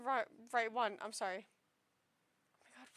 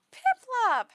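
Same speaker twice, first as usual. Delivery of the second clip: excited squeal; 10 semitones higher, 13 dB louder than usual. Real, not acted. Gasp at favorite card.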